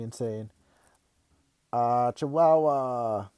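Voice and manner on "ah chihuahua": slow, drawn-out, louder put-on cry; playful toy voice. Dad shaking the stuffed gecko at his kid, hammed-up exclamation.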